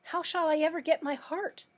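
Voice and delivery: high-pitched dismayed appeal; lamenting. A narrator's reading. Tin Woodman lamenting his lost hope.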